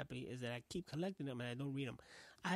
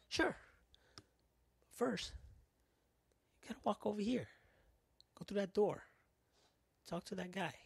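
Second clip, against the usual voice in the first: fast-food worker; 6 semitones higher than usual. Acted. Mimicking Burger King worker giving directions.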